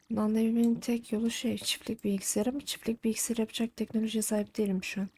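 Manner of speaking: ordinary talk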